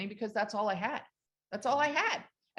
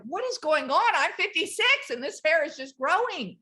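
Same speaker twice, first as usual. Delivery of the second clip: amazed; 8 semitones higher, 4 dB louder than usual. Retold or imitated. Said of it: "I'm like".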